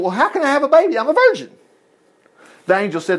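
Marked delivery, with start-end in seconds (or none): 0.0-1.5 put-on a touch higher, lightly puzzled young woman; lightly voiced Mary. Mary asking the angel how she can have a baby, lightly voiced. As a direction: ask it like a bewildered girl. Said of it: "Mary asked the angel".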